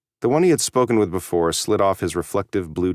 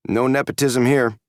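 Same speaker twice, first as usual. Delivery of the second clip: dry, mocking remark; sarcastic; 6 semitones higher than usual. A narrator's reading. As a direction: say it with a sarcastic edge. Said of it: "he added sarcastically".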